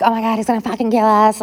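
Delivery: fast, high mock panic; retold panicked screaming. Retelling kids in dad's car fearing death, mock panic. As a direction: gabble it like a frightened kid, without screaming. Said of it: "everyone was screaming".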